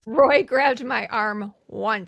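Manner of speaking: loud, slow and deliberate; loud slow quote. Retold or imitated. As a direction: drag out each word loudly. Quoting the movie line, loud, slow.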